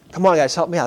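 normal talking voice

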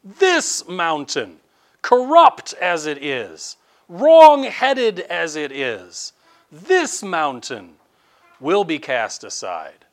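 rising, forceful sermon build; fervent preaching. Acted. Preacher thundering at the congregation, forceful. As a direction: pound each phrase to the congregation.

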